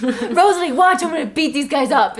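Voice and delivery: lightly voiced jock bravado; cocky Emmett. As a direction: brag to impress girlfriend. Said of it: "He goes".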